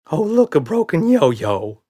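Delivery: high, loud excited squeal, slow. A narrator's reading. Red spots a broken yo-yo, squealing with delight. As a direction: squeal over the treat. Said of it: "squealed Red".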